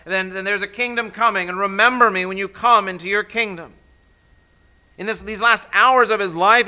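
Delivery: high, loud, fast preaching climax; preaching at full force. Acted. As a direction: preach it out, rising to the peak.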